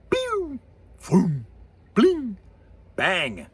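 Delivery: separate, punchy mouth sound effects; imitated laser-gun noises. Shown as a demonstration. Foley guy guessing what a space gun sounds like, mouthed effects. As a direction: try out different sci-fi blaster sounds. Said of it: "what's it sound like?".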